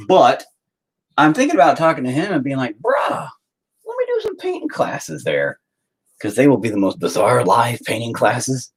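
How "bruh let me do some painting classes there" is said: much higher, softer pleading ask; asking his friend Matt for a favor, squeaky; mock pleading. Imagined ask to buddy.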